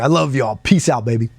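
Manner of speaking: ordinary delivery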